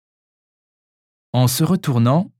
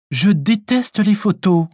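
put-on high-pitched old woman's grumble; grumbling old lady; 9 semitones higher than usual. A narrator's reading. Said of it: "ronchonna la vieille dame". (vieille dame qui ronchonne)

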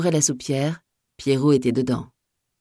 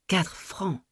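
softer, strange muttered "Quatre francs."; indignant mutter; 4 semitones higher, 7 dB quieter than usual. A narrator's reading. Widow walking off, muttering the price indignantly. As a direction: grumble the price under your breath, offended. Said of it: "indignée".

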